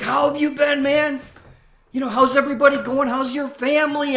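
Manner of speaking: high, loud, fast eager chatter; rapid over-the-top buddy talk; gushing friendliness. Retold or imitated. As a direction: pile on the friendly questions. Keeps gushing at confused stranger.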